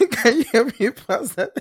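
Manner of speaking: high, over-polite pleading, giggling; exaggerated politeness. Shown as a demonstration. Showing co-host three magic words at once, playfully over-polite. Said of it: "you can even add three at the same time".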